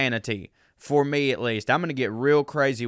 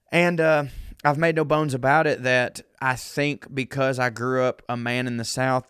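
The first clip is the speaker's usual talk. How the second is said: normal talking voice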